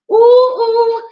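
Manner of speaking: high loud delighted ooh; excited squeal. Real, not acted. Showing viewers her tooth gap, squealing with delight. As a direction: squeal with glee.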